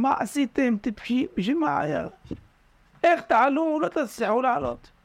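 high, reproachful scolding from above; mock their foolishness from the rim; scolding onlookers. Frogs at the rim scolding the fallen ones, high and reproachful.

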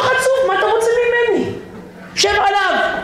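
very high, indignant retort; hammed-up scolding at a high pitch; mock angry attendant. Flight attendant to passenger, high mock-indignant retort. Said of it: "היא אומרת לו".

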